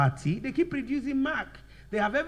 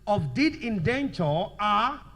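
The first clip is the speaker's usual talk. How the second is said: slow, forceful, broken into short chunks; slow dictation; 4 dB louder, slower than usual. Shown as a demonstration. Teacher dictating an example to students, slow dictation. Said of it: "So let's write".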